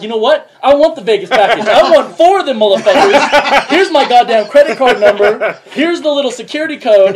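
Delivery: laughing mock bravado buying four packages; triumphant caller. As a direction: slam down the order in triumph. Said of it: "He's like".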